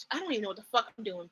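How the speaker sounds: usual speaking voice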